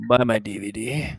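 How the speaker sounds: low-pitched creepy sales line; close in the ear. Acted. Teacher gets close to your ear, low voice. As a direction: lean in and say it low. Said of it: "he gets close to your ear".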